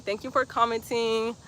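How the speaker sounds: usual speaking voice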